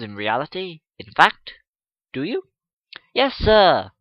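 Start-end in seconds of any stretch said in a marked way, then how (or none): 1.0-2.4 probing question. Acted. Gentleman questioning the class. 3.1-3.9 eager pupils' answer. Acted. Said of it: "from one half".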